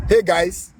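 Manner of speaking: usual speaking voice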